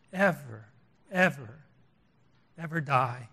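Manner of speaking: hushed, intimate passion, long pauses; Jesus. Acted. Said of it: "speaking like this with such passion".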